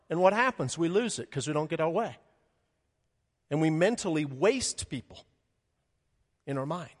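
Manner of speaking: usual speaking voice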